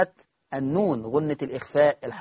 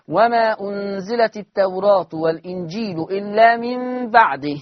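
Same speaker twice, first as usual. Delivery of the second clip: slow chanted tajweed recitation; Quran recitation; 4 semitones higher, 6 dB louder than usual. Acted. Teacher recites the verse to students, slow and chanted.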